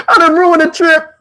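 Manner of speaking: laughing through the words; high laughing confession. Real, not acted. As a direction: crack up as you say it. Laughing while telling it.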